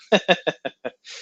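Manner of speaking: high chuckle falling in pitch; laugh. Real, not acted. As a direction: laugh lightly after your own remark, starting high. Chuckle after joking about partying.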